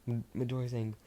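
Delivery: ordinary delivery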